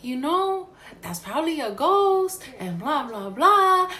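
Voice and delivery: lightly voiced mom, pitched high; imitated mom. Daughter retelling mom's ghost theory, lightly mimicking her. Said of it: "I called my mom and she was like".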